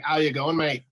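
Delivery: lightly Australian-accented greeting; a hint of Australian accent on "mate". Shown as a demonstration. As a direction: demonstrate a casual Aussie hello. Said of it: "that's all they say".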